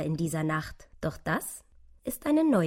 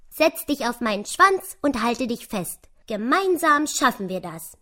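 louder, higher, encouraging squirrel; ermutigendes Eichhörnchen; 6 semitones higher, 6 dB louder than usual. Acted. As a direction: urge the mouse on confidently. Squirrel encourages frightened mouse. (aufmunternd und bestimmt)

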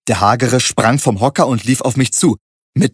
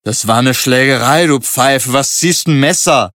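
1 exasperated scolding; 3 semitones higher, 4 dB louder than usual. A narrator's reading. Jas scolds the narrator for drawing a knife. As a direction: chew him out for the knife.

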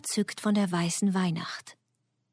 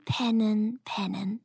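fading into sleep; 3 semitones higher than usual. Acted. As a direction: let pennen drift off into sleep. Dachs wiederholt pennen.

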